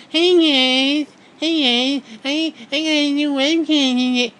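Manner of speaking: very high, loud squeaky mimicry; mock a squealing webcam performer; annoying webcam girl. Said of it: "And she sounds like that".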